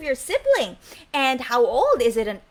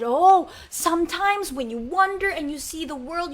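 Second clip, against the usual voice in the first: ordinary delivery, nothing marked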